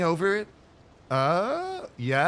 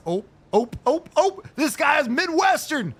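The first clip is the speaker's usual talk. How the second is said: high, louder excited oh oh; excited discovery; 11 semitones higher, 5 dB louder than usual. Real, not acted. Gamer thrilled spotting a Midwestern character, high. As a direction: gush over the find.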